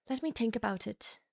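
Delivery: soft Irish accent, feeling it out; murmured practice line in Irish accent; trying an Irish accent. Shown as a demonstration. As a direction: quietly test an Irish accent. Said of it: "I would say that my Irish accent".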